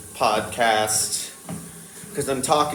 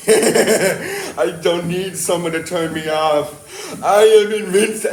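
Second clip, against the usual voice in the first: slow, laughing robot boast; boastful rogue AI; 5 semitones higher, 5 dB louder, slower than usual. Pretend. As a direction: gloat like an invincible machine. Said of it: "and be like".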